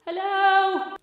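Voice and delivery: loud, high hello called from the sidewalk; calling out. Pretend. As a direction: call it toward the open door.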